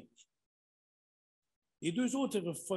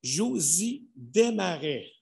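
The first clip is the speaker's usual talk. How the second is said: loud, high announcer calling names; booming prize announcer; 4 semitones higher, 6 dB louder than usual. Acted. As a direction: call out each nominee to the hall. Announcing the nominees.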